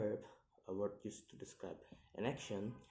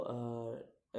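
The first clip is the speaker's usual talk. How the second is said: ordinary delivery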